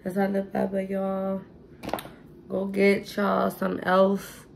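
normal talking voice

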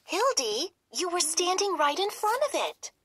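high, fast eager telling; excited realization. Acted. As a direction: rush to explain the mirror.

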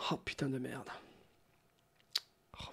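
ordinary talk

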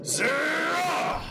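high shouted attack call; battle cry. Acted. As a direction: bark the attack name. Villain unleashes attack.

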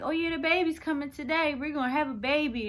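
put-on cheery nurses announcing the baby; mock cheerful staff. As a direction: imitate the upbeat staff. Mimicking cheerful hospital staff.

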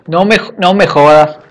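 loud exasperated cry; frustrated outburst. Real, not acted. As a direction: blurt it out in disbelief. Bear appears in game.

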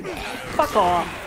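yelled at the zombies; angry shout. Real, not acted. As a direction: snap it out loud. Yelling at zombies in game.

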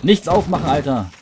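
high, loud panicked yell; panicking gamer. Real, not acted. Panics in fight.

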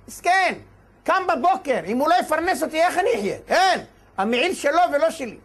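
high, insistent pleading; impassioned plea. Acted. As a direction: plead hard on your son's behalf. Father pleads for son's sake.

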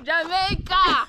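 slow, high and loud. Real, not acted. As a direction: repeat it out loud, drawn out. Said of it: "Why are you whispering?".

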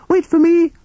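high piping shout from behind; calling out. A narrator's reading. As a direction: call after them in a tiny high voice. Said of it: "called Toad".

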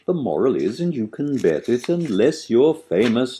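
plain reading voice

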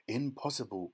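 ordinary delivery, nothing marked

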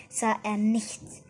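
plain reading voice

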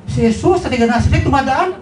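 can it be true, asked up high; astonished question. Acted.

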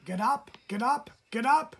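high, loud bird cry; shouted wake-up call. A narrator's reading. Blackbird shouting at the sleeping fawn to wake up. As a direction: squawk it out to wake him. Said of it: "shouted the red-winged blackbird".

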